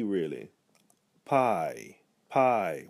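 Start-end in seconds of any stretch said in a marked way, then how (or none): 1.2-2.9 "Pie" with a long vowel and a half Y at the end; elongated diphthong. Shown as a demonstration. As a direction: stretch "pie", almost closing on a Y. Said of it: "We almost make a Y sound at the end".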